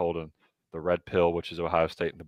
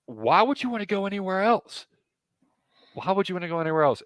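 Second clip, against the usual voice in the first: incredulous plea; 11 semitones higher than usual. Pretend. Pleading with the recruit.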